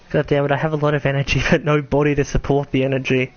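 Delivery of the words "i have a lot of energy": ordinary talk, nothing marked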